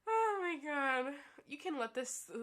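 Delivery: normal talking voice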